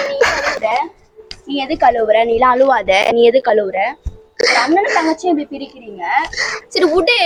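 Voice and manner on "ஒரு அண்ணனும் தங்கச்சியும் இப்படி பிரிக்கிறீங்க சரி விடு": tearful words through sobs; sobbing sister pleading, voice wet with tears; crying. Acted. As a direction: cry it out, hurt by her brother. Sister cries after being sent away.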